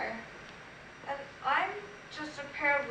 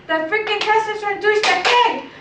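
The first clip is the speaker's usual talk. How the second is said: loud, higher-pitched angry rant peaking at the end; furious outburst; 5 semitones higher, 11 dB louder than usual. Acted. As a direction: explode with outrage at the boyfriend.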